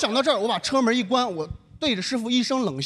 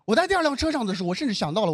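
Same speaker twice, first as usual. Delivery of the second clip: ordinary delivery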